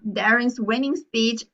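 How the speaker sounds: ordinary talk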